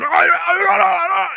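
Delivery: high, loud mock Scottish yelling; exaggerated wordless Scottish shout; imitated Glaswegian gibberish. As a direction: bellow incomprehensible noise like a Glasgow local. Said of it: "they'll say something".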